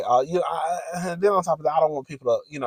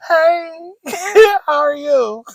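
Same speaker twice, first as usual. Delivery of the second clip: high, loud, hammed-up hello through laughter; giggly flirty greeting; 8 dB louder, much slower than usual. Replaying her hello to the big man, giggly flirting. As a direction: squeal a mock flirty greeting.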